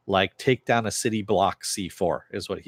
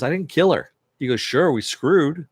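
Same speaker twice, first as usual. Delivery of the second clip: mock taunting Homelander; 5 semitones higher, 4 dB louder than usual. Homelander taunting Billy, retold.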